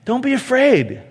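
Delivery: ordinary talk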